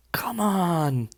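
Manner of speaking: whispered, near crying; choked whisper, fighting tears; real grief breaking into a whisper; holding back tears. Real, not acted. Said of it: "I don't wanna cry".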